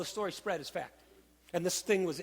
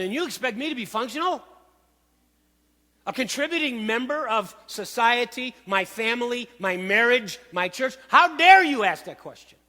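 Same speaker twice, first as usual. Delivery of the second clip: building from a higher, louder whine to a full outraged shout; indignant sick man; 5 semitones higher, 8 dB louder than usual. Retold or imitated. Pastor voicing the sick man's outrage at Jesus, shouting. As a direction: climb to an indignant outburst on "How dare you". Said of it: "what I hear in his answer is, "Yes, but".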